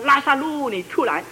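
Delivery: high, loud call of the name. Retold or imitated. Jesus summoning only Lazarus by name, high loud call. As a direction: sing out the name to summon him. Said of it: "所以他必定说".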